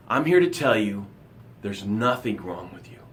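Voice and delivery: ordinary talk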